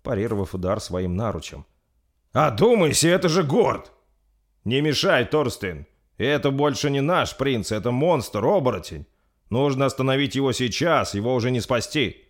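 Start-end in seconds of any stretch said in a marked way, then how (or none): 2.3-3.9 high, loud shouted appeal; urgent, pitched-up protest; desperate plea. Acted. Pleading to stop a killing. 4.6-12.2 raised, higher insistent voice; urgent, forceful insistence; heated argument. Acted. Captain arguing to kill the monster.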